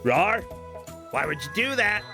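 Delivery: ordinary delivery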